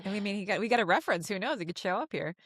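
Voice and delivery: ordinary talk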